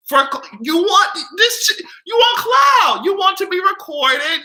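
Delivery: high, loud outraged shout; angry shout. Real, not acted. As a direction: yell it out in real indignation. Angry rant about clout chasing.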